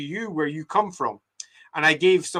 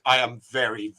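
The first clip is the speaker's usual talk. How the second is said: usual speaking voice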